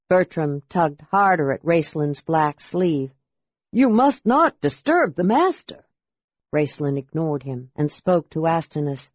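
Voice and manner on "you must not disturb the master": high-pitched, fussy protest; agitated, higher-voiced underling; anxious servant. A narrator's reading. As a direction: scold the visitor in a high servant's voice. Servant protests to intruding visitor.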